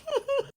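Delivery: squeaky high embarrassed laugh; high awkward laugh. Retold or imitated. Caught in the car by his brother, high awkward laugh. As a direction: let out a high awkward giggle.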